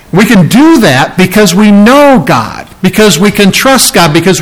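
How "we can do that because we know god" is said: usual speaking voice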